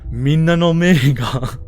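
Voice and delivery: Sai's voice. Acted. Streamer voicing Sai rebuking the cheater, slow and loud. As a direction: proclaim it slowly to the room. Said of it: "so excited to use the voice".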